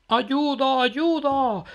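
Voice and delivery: very high, loud scared cry for help; frightened shriek. Acted. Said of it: "chilló Matías asustado".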